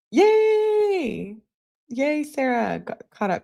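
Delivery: sarcastic cheer. Real, not acted. Sarcastic cheer at caught killer.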